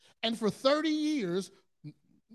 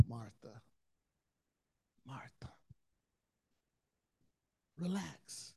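much softer, hushed and tender; calm her down like a frightened child; soft, reassuring whisper to Martha; gentle whisper; 8 semitones lower, 13 dB quieter than usual. Retold or imitated. Jesus soothes Martha.

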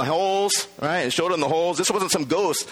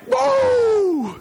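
joyful shout. Acted. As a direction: burst out with joy. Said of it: "It was like".

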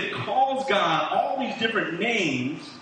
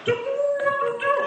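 trumpet toot imitation. Preacher imitating a victory horn blast, high and hammed-up. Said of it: "you're like".

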